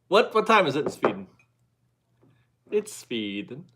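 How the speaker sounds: mild mock Swedish accent; lightly put-on Swedish accent. Host greeting viewer Kiki in Sweden, a mild mock Swedish accent. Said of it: "That's better".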